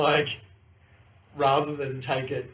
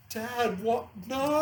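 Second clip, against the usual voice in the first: high, exasperated disbelief; 10 semitones higher, much slower than usual. Retold or imitated. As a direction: cry out at dad in disbelief. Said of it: "She was just like".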